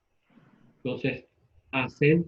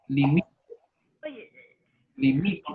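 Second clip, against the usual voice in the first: "Limite" as li-MI-te, rising at the end; stress on the middle syllable. Shown as a demonstration. Teacher quizzing the class, "Limite" stressed on MI. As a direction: stress MI in "Limite", lift the ending. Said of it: "Palabra llana con pronunciación en la".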